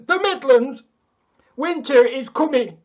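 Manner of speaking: dramatic shout. Acted. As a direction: announce it like an epic herald. Shouting about the cold north.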